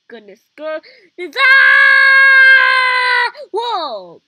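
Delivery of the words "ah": loud, high scream of thrill; high delighted shriek. Real, not acted. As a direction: let out a real screaming yell as you fall. Marble drops, big thrill.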